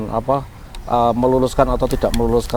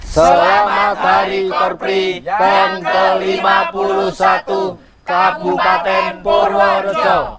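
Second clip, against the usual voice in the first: loud gung-ho soldier; 7 semitones higher, 3 dB louder, slower than usual. Acted. Anniversary slogan chanted at close.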